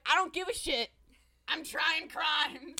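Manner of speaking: loud, high and brash; defiant retort. Retold or imitated. Barba brushing off everyone's objection, defiant.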